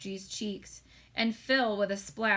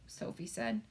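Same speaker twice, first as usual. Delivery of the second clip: ordinary delivery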